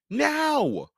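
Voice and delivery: put-on boy urging brother; urgent command. A narrator's reading. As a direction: insist right now.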